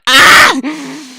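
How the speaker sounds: sharp frustrated ah; frustrated yell. Real, not acted. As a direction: yell out in exasperation. Exasperated at failing to speak.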